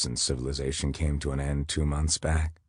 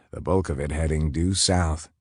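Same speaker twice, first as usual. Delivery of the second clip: usual speaking voice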